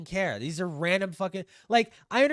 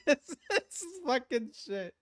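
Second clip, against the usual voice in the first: ordinary delivery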